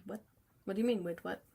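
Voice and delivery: normal talking voice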